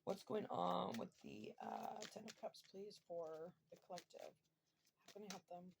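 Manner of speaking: soft, far-off murmur to the cards; hushed request. Real, not acted. Reader softly asks the tarot deck for clarity, hushed. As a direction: ask the deck quietly, almost to yourself.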